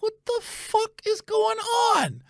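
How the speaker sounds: very high, drawn-out mock squeal; slow, high-pitched disbelief; baffled outcry. Man alarmed by woman yelling at his door, squealing bafflement.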